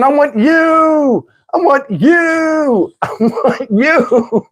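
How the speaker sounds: high, very drawn-out whimpering plea; mock-cry with desire, stretching each word; imitating tearful woman. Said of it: "she kept saying".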